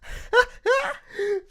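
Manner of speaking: high-pitched burst of laughter. Real, not acted. Streamer cracking up at a bought cameo, laughing.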